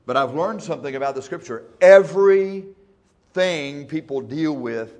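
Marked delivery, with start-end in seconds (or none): 1.8-2.8 "Everything" burst out loud and high; shouted word. Real, not acted. To the congregation, a sudden loud cry. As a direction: shout "Everything" to grab the room. Preacher punches key word.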